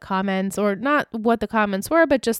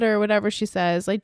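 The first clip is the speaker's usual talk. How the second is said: usual speaking voice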